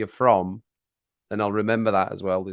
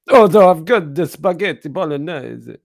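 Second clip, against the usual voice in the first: loud, high mock Italian accent; hammed-up Italian, pitched up and loud; 6 semitones higher, 8 dB louder than usual. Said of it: "That's my Italian accent".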